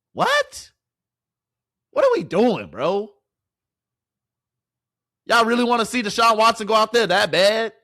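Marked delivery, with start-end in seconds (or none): 0.1-3.1 jumps far higher, disbelieving; incredulous what. Real, not acted. Streamer can't believe the rep split, high incredulous. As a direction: shoot the word up in disbelief.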